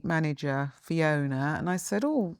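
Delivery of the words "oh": usual speaking voice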